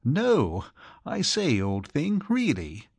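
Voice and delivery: high start sinking into weak protest; mildly flustered reluctance; quivering. A narrator's reading. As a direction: back away from the request nervously. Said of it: "Archie quivered".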